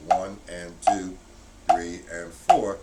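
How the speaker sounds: "One, and two, three, and four" counted slowly and evenly; slow counting. Shown as a demonstration. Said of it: "play just the rights".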